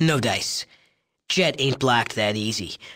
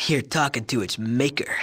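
normal talking voice

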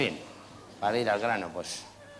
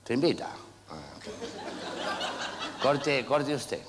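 doctor's voice; 3 semitones higher than usual. Retold or imitated. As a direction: lightly play the polite doctor offering the cut. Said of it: "va el médico y te dice".

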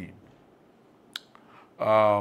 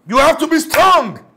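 loud, high, slow exhortation; shout; 13 semitones higher, 13 dB louder, slower than usual. Acted. Pastor urging the congregation to stand strong, loud slow shout. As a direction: bellow it to rally them.

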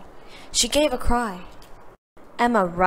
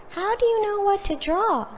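put-on high, childlike questioning voice; children crowd round Emma, curious; children's voices; 12 semitones higher than usual. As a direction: pitch way up like curious kids. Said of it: "the children asked".

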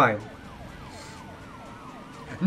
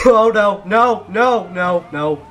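loud, high string of panicked noes; panicked yelling that tapers down; alarmed cries; 4 semitones higher, 7 dB louder, slower than usual. Real, not acted. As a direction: cry out as disaster hits, then ease off. Car crashing, real alarm in game.

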